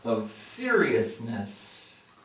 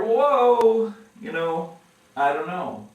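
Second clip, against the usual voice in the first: startled hedge; 6 semitones higher, 8 dB louder than usual. Retold or imitated. Believer hedging at God.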